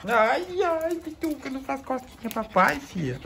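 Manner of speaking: a tender coo to stop the tickling; soft pet talk. Real, not acted. Owner softly telling his puppy to stop, high tender coo.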